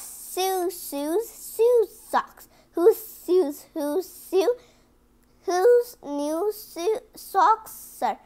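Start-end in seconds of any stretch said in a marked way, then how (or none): none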